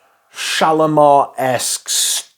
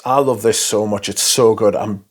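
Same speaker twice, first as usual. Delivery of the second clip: normal talking voice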